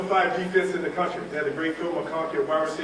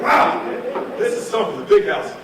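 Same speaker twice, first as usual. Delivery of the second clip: wide-eyed wonder, rising up high; awed exclaim; 4 semitones higher, 4 dB louder than usual. Retold or imitated. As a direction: gasp at the size of it. Awe at the huge stadium.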